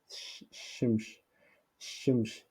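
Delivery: "shimsh" with sh at both ends, softly; prononciation fautive. Shown as a demonstration. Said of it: "euh, c'est faux". (« shimsh » doucement, avec ch final)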